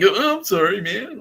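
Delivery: high, hammed-up tearful apology; mock crying. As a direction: imitate a wailing, sobbing apology. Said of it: "is now, like, crying".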